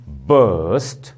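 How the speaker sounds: "burst" drawn out with a firm, strong onset; loud stressed syllable. Shown as a demonstration. Teacher showing students a loud syllable, stressed demonstration. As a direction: hit "burst" as one stressed, stretched syllable. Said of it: "loud, na?".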